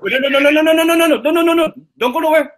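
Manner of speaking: rapid high, loud no's; frantic pleading. Real, not acted. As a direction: beg them not to hang up. Agent hanging up, panic.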